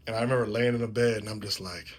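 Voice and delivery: normal talking voice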